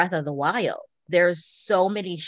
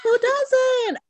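high, delighted squeal; excited squeal; 4 dB louder than usual. Real, not acted. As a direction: gush it out, thrilled. Excited echo of friend's quip.

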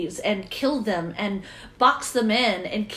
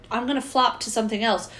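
ordinary talk

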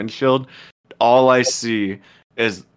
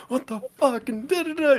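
high mock scream of outrage; retold scream; 4 dB quieter than usual. Girlfriend screaming at the truck driver, high mock yell. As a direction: shriek it like the furious girlfriend. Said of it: "She came out screaming at this dude".

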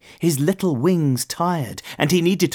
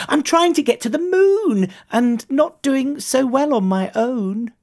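high-pitched struggling moth voice; little moth, pitched way up; earnest little moth; 12 semitones higher than usual. A narrator's reading. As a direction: confess the trouble in a small high voice.

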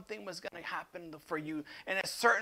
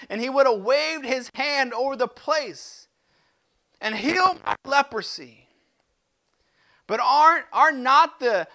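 loud, higher, indignant outburst; furious Naaman; 5 semitones higher, 7 dB louder than usual. Acted. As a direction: rage at the insult to his pride. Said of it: "Naaman became furious".

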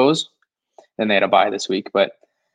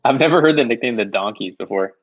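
ordinary delivery

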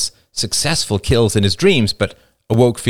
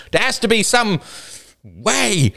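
high, desperate insistence; defiant cry; 14 semitones higher, slower than usual. A narrator's reading. Man turns on the floating angels, defiant cry. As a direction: cry it out, stretching the last word. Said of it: "cried another man suddenly, turning defiantly".

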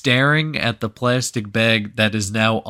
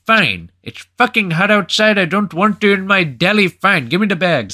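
put-on louder, higher Indian-accented exasperation; irritated mock Indian accent, raised voice; 8 semitones higher, 5 dB louder than usual. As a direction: imitate an Indian shopkeeper grudgingly caving. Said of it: "the Indian guy caves".

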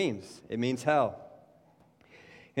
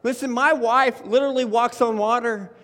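louder, higher, hammering the point; preaching at full force; 6 semitones higher, 4 dB louder than usual. Acted. Pastor urging the church he cannot save himself, preaching loud. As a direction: preach it hard to the room.

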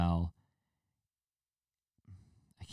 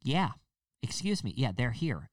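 higher, prim phone voice; mock phone tattler; 5 semitones higher than usual. Busybody lady on the phone reporting skaters. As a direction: report them primly to the police. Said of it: "she's just on the phone".

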